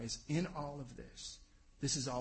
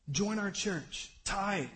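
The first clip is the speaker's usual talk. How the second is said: mock misquote; 6 semitones higher, 3 dB louder, much slower than usual. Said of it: "No, that's not what it says".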